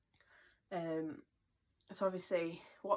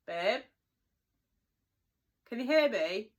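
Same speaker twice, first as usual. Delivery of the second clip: loud call to another room; 6 semitones higher, 10 dB louder, slower than usual. Real, not acted. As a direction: call out to someone far away. Calling to partner in another room.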